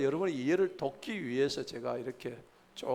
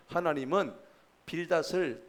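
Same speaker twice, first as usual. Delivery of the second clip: ordinary talk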